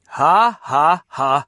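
loud spoken mock laugh; deep rusty jeer. A narrator's reading. Big boys at soaked Ivan, jeering laugh. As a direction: shout out a bully's ha-ha. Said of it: "råbte de store drenge med deres dybe, rustne stemmer".

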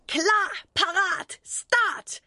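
high, ringing race-start call; counting off a race. A narrator's reading. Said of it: "tæller Boris". (højt startråb til kapløb)

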